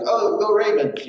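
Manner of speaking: high, slow cheerful call to a fan; cheery fan shout. Retold or imitated. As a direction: greet a stranger like a fellow fan. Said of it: "you're like".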